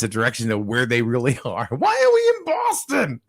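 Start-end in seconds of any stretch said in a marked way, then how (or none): 1.7-3.2 put-on very high, stretched-out child's yell; mock baffled cry. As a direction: imitate a kid's loud disbelief, drawing it out. Repeats the child's baffled outcry.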